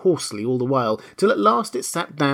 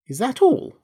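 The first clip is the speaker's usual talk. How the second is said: high-pitched, indignant question; swallowed anger; 12 semitones higher than usual. A narrator's reading. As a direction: ask it high, holding anger down. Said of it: "swallowing down her anger".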